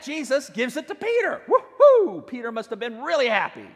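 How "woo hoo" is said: loud, high woo-hoo cheer; joyful whoop. Acted. Preacher cheering for Peter with a loud woo-hoo. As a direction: whoop for joy.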